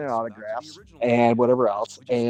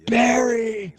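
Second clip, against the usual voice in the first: high, drawn-out fan's call; re-enacted excited holler; 11 semitones higher, 4 dB louder than usual. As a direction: call to a star across a crowd. Fan calling to star on stage.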